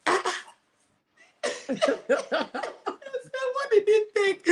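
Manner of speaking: high squeal of laughter; laughing. Real, not acted. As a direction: laugh through the question. Laughing while retelling.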